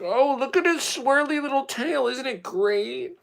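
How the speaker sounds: very high, loud cooing; high doting pet talk, slowing at the end; dog-gushing voice. Acted. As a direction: gush over a dog in put-on baby talk. Acted gushing over a dog.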